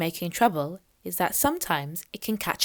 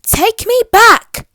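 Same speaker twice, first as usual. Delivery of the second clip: high, loud and slow insistence; demanding; 13 semitones higher, 12 dB louder, slower than usual. Acted. Captured girl demanding the mermaid return her: loud demand. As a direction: stamp your foot and demand it. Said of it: "demanded Little Miss Trouble".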